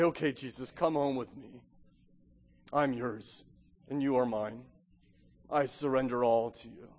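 low, soft and yielding; quiet surrender prayer. Acted. Preacher models answering Jesus in surrender, quiet prayer. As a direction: pray it gently, voice dropped.

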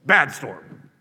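loud stern telling-off like to a dog; scolding a pet. Retold or imitated. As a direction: scold the storm like a naughty puppy. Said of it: "He rebuked it".

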